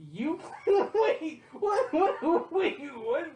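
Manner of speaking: high, loud, laughing what-what; cracking up in disbelief. Real, not acted. Laughing at his friend's weird claim, incredulous.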